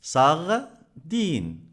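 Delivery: "Sardine" as sar, pause, dine; teacher's syllable split, sar... dine in "Sardine". Shown as a demonstration. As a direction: break "Sardine" into two separate beats. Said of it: "Deux syllabes".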